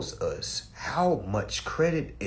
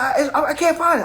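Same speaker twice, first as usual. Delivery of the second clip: mock stammer; 13 semitones higher, 7 dB louder than usual. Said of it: "I said".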